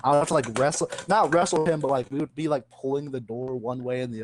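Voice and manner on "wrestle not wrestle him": ordinary talk, nothing marked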